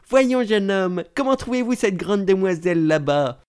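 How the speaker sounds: lightly voiced older lady questioning a young man; elderly lady. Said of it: "J'étais à côté d'une dame, assez âgée". (voix de dame assez âgée)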